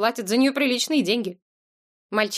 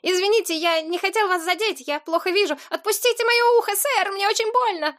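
put-on very high pleading boy; thin pitiful little voice; 11 semitones higher than usual. A narrator's reading. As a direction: plead in a put-on tiny voice, rising as it hurts. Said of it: "специальным тонким голоском". (тонкий умоляющий голосок мальчишки)

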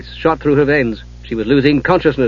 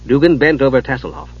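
ordinary delivery

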